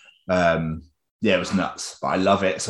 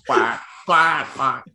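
lightly voiced decrepit duck; old duck voice; 6 semitones higher, 3 dB louder, much slower than usual. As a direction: quack like a tired old duck. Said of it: "decrepit and old".

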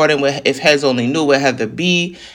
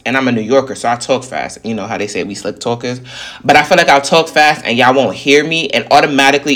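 ordinary delivery

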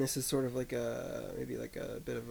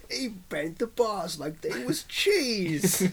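slow Cockney, breaking into laughter; laughing through a slow Cockney line; Cockney accent; 8 semitones higher, 5 dB louder, much slower than usual. Pretend. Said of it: "I was gonna try to do a Cockney accent".

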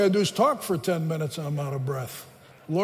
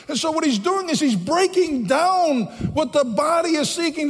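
higher-pitched, driving sermon delivery; fervent preaching; 7 semitones higher, 3 dB louder than usual. Real, not acted. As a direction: preach it with mounting urgency. Preaches with rising fervour.

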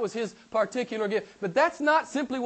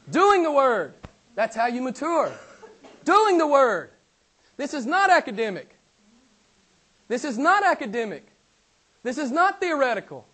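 raised, punchy declarations with falling ends; emphatic preaching; 3 semitones higher, 4 dB louder than usual. Real, not acted. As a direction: drive each short sentence home.

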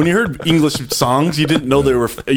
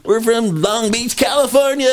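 imitated crowd cheer; 10 semitones higher than usual. Tourists answering the announcer in Cancun, high mock cheering. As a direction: imitate tourists proudly calling out their hometown. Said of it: "Yeah, everyone screams".

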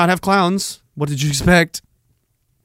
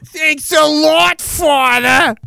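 much higher, slow smug voice; smug Janice; 11 semitones higher, much slower than usual. Pretend. Janice to her dying father, smug and high. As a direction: thank him sweetly and smugly. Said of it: "Janice was like".

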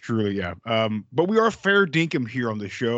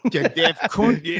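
mock Australian; 3 semitones higher, 5 dB louder, slower than usual. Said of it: "I'd just do more Australian".

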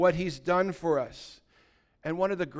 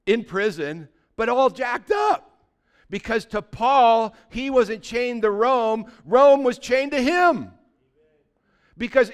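louder, higher, slow and driving; fiery preaching; 5 semitones higher, 6 dB louder than usual. Acted. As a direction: build the crowd up with fire. Preaching at full force.

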